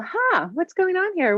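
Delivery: high, drawn-out musing huh; curious puzzling. Retold or imitated. Practitioner puzzles over a patient's lab results, curious puzzling. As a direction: wonder aloud, slow and high-pitched.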